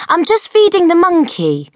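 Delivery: tossing it off as if it were normal; Enid. A narrator's reading. Enid casually explains her monkey to Mildred, high girlish voice. Enid answers through door.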